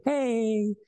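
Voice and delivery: very high, drawn-out sing-song announcement; proud child bursting to share news; excited kid. Retold or imitated. Child announcing election win to dad, excited. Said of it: "and I'm all excited".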